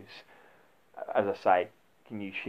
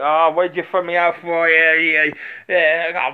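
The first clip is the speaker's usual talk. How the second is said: put-on higher, louder whiny drunk protesting being thrown out; mock drunk; 8 semitones higher, 11 dB louder, slower than usual. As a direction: play a sulky drunk customer, high and loud. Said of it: "on a drunk who's".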